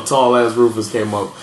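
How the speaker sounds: normal talking voice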